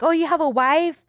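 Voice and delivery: imitating her own surprised asking; mock surprise. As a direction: act out a pointed, innocent query. Retold pointed question to the men.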